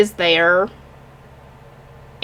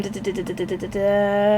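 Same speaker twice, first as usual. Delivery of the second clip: absent-minded da-da-da; 2 semitones lower, 9 dB quieter than usual. Real, not acted. Reviewer looking up the characters' names, idle quiet da-da filler. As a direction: fill the wait with quiet da-da-das.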